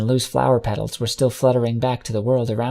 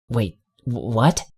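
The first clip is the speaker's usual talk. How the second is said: breathless stammer; slower than usual. Acted. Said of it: "I breathlessly stammered".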